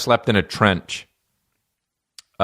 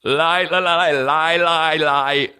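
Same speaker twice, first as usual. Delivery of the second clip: imitating a stammer stuck on lie, pitched higher; stuttering king; 5 semitones higher, slower than usual. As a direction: stammer through lie, lie like the King's Speech. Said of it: "where he's just like".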